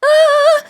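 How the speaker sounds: sudden loud, high squeal of energy; high-pitched "ah". Pretend. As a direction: let out a playful, piercing "ah". Mock reaction to quote's focused energy.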